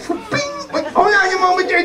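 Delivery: high, fast cartoon dog voice; antic dog. Dog halting his own exit, high hurried squeak. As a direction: squeak it out in a hurry. Dog darts about between acts.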